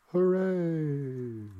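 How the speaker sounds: long, high, loud hooray with no joy behind it; empty cheer. A narrator's reading. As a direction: cheer loudly but hollowly.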